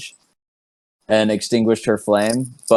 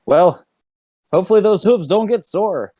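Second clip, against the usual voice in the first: teasing quip; 9 semitones higher than usual. Acted. Host teasing the satyr.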